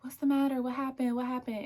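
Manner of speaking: high, loud, insistent questioning; hammed-up anxious friend firing questions; imitating pestering friend. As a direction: pester with mock concern. Imitates friend trailing and pestering her.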